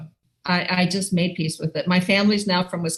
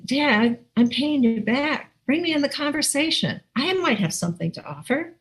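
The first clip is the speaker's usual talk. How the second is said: higher-pitched plea to be included; mock inner plea; 7 semitones higher than usual. Daughter left out of dad's finance talk, pleading. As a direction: act out wanting in on the talk. Acts out silent plea to dad.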